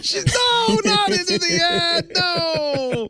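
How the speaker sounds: high shrieking protest; high-pitched mock scream of refusal. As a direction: shriek it like a panicked woman. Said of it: "I hear Miss Lisa scream".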